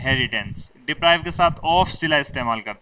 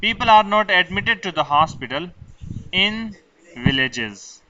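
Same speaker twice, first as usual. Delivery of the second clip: each word laid out slowly for students to copy; slow dictation; 2 semitones higher, much slower than usual. Shown as a demonstration. Teacher dictating to students, very slow.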